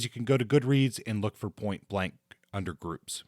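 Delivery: normal talking voice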